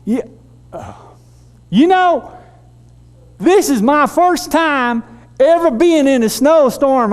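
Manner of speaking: put-on Mississippi accent. Terry explains his first snowstorm, in a high Mississippi accent. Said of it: "get the accent right".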